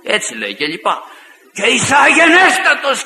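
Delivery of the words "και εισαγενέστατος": high, raised-voice imitation of protesters; retold shouting. Angry believers insulting the visiting preacher, retold shouting. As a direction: mimic people shouting at full pitch. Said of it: "και φώναζαν στη διαπασόν".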